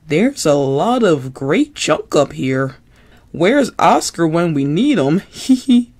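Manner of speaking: put-on bouncy puppet kid, playful and giggly; Ernie voice.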